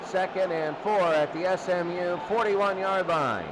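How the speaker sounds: usual speaking voice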